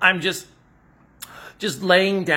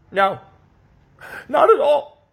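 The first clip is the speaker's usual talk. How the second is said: high tearful wail, much louder; crying denial; 11 semitones higher, 8 dB louder than usual. Acted. Parody fan denies crying, high tearful wail. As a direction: insist it's fine while breaking into tears.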